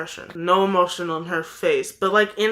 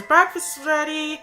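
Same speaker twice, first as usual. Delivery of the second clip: yelled upstairs; 8 semitones higher than usual. Retold or imitated. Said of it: "yelled upstairs".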